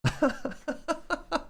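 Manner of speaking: high, soft giggling laugh; chuckle. Real, not acted. Streamer amused by their own story, high soft laughter. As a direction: let out a quiet high chuckle.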